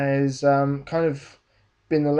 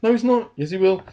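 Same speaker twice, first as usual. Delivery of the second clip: high, louder back-and-forth, dropping a little for the reply; excited mock bickering; 6 semitones higher, 5 dB louder, faster than usual. Friends arguing whether the car will make it, excited mock bickering. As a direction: reenact two viewers contradicting each other in suspense.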